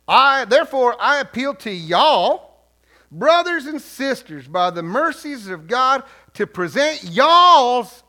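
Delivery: loud, slow Southern drawl; Southern accent. Pretend. Preacher reading Paul's appeal to the church, drawn-out Southern accent. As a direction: proclaim it slowly in a Southern accent. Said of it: "the reason I read it this way".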